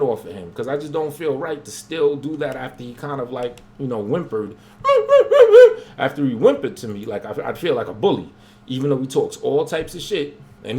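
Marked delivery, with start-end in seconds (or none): 4.8-5.9 loud laugh. Real, not acted. Mocking a rival's whimpering.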